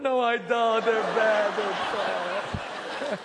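waving off praise through a laugh; laughing self-deprecation. Retold or imitated. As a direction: fade into laughter as you deny it. Modestly refusing sermon praise.

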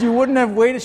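incredulous question. Retold or imitated. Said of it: "I said".

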